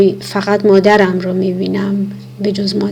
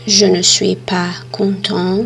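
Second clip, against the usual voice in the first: slow model sentence; much slower than usual. Shown as a demonstration. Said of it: "model sentence for learners".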